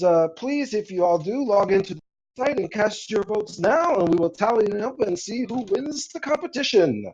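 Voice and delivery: high-pitched MC addressing the audience; event announcer. Acted. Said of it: "You see the announcer come up".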